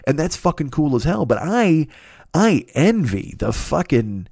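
ordinary delivery

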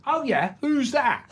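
high, drawn-out Cockney query. Retold or imitated. Cockney told of the greatest living actor, high sceptical Cockney. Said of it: "this Cockney comes in".

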